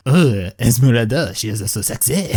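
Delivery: slow, hammed-up French accent on request; mock French accent. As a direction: croon it like a cartoon French lover, in a French accent. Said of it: "give us more of that French accent".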